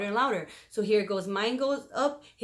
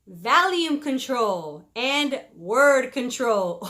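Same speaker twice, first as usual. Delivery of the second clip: slow, louder, each word weighed; slow announcement; 2 semitones higher, 6 dB louder, much slower than usual. Shown as a demonstration. Vlogger announcing marriage tip, slow and loud. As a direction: unveil the tip deliberately. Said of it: "Are you ready?".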